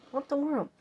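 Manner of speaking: high-pitched what the world; stunned by the machine; fed-up high exclamation; baffled disbelief. Retold or imitated. Disbelief at the error again.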